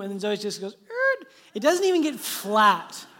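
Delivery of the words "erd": short high squawk of a stopping car; imitated screech halt. Dad shows daughter's car stopping, high screech noise. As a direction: mimic a sudden high screech.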